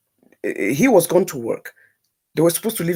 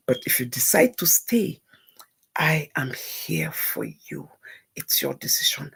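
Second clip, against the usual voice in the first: tender whisper; 4 semitones lower, 8 dB quieter than usual. Retold or imitated. Telling her friend she'll stand by her, tender whisper. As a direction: reassure her gently, barely voiced.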